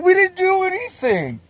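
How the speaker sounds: very high, whiny denial; mock-innocent protest. Pranksters denying it to the victim, high-pitched innocent whine. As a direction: squeal innocence while cracking up. Pranksters deny it while laughing.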